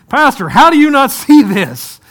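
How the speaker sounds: high, loud mock disbelief aimed back at the pastor; exasperated retort. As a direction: turn the question back on him, incredulous. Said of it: "he's looking at me saying".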